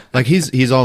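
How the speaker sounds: ordinary delivery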